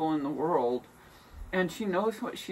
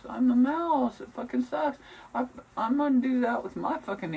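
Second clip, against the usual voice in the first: heated rant; 8 semitones higher, 3 dB louder than usual. Real, not acted. Ranting about the cat.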